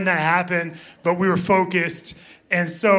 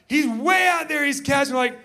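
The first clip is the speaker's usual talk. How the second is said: normal talking voice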